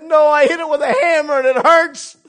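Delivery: put-on loud, high child crying out in pain; high pained child's yell; child's shout. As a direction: yell it like a hurt little boy. Boy crying to operator on phone.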